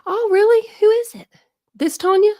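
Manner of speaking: high and loud delighted surprise; surprised. Real, not acted. Host reading chat about another Tanya, surprised squeal. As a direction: squeal with amazement at the news.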